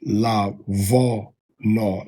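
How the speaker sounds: "la vo no" said slowly with a v. Shown as a demonstration. Teacher drills the v reading, slow. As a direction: stretch out "la vo no", v sound. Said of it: "This telling you to say "v".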